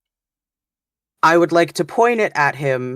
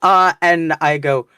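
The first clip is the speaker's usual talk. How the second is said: ordinary talk, nothing marked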